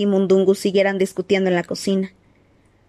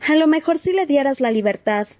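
put-on a higher, pleading girl's voice; 6 semitones higher than usual. A narrator's reading. Hermione begs Sirius to pity the elf, higher and pleading.

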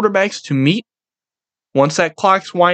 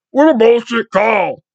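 dumbass whiny-player impression, pitched up; Patrick Mahomes; 6 semitones higher than usual. Quarterback complaining to opponent after the game, mocking dumbass voice. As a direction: put on a higher, mocking dumbass voice. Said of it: "with his fucking dumbass voice".

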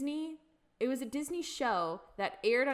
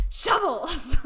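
belted out loud and higher; loud punchline; 4 semitones higher, 12 dB louder than usual. Retold or imitated. Quoting the show's punchline to her friend, loud and gleeful.

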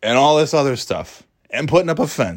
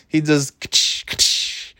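whip crack sound; 3 semitones higher, much slower than usual. Retold or imitated. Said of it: "like, the whip".

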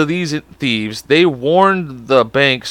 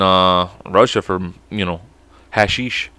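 ordinary talk, nothing marked

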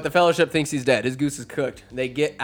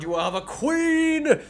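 higher, wild-eyed proclamation; freaking out; 12 semitones higher than usual. Acted. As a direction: rant like power takes hold. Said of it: "she starts just freaking out".

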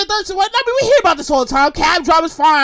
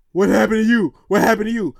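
low, toned-down family voice; family member asking; 7 semitones lower than usual. Acted. Family answering her big news, low-voiced questioning. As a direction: drop the voice for the curious relatives.

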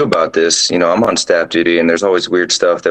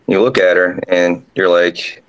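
ordinary talk

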